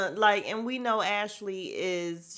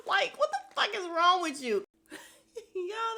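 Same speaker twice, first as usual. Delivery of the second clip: high-pitched, talking through real laughter; cracking up; 10 semitones higher than usual. Real, not acted. Host finds the retold jab hilarious, cracking up. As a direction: laugh helplessly through the words. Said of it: "that shit was so funny".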